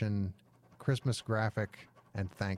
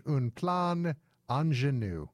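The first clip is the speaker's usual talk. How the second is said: slow hammy fake French accent; 5 semitones higher, slower than usual. Shown as a demonstration. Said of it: "my fake French can only get me so far".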